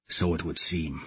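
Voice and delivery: low, very soft resigned answer; grim murmur; old warrior murmuring agreement; hushed reply. Acted. Whispered reply.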